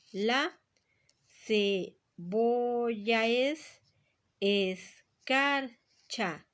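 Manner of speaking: each syllable drawn out very slowly. Shown as a demonstration. Teacher to student, counting syllables, drawn out very slowly. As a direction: stretch it out so every syllable can be counted. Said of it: "Voy a dividir en sílabas".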